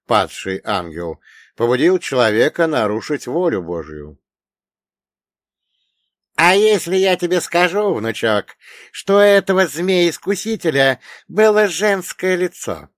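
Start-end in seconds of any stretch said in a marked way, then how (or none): 6.4-12.9 high, loud elder quizzing a pupil; raised, emphatic voice of a learned old man; old storyteller pitched high and forceful; wise old dead man. Acted. Old man teases with revelation.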